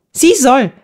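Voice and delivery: high, excited interruption; eagerly chiming in. A narrator's reading. Gertrud completes Eva's sentence, high and excited. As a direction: jump in to finish her sentence. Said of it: "vollendete Gertrud den Satz".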